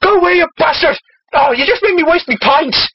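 put-on feisty Irish accent. Irishman berates demon over spilled pints, feisty Irish. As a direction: scold in a hammed-up Irish accent. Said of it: "his feisty Irish spirit decides to attack them".

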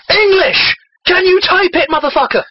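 high, punchy demand; mock outrage. Reader redoes his tirade about bad English, mock outrage. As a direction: rail at the writer with hammy exasperation.

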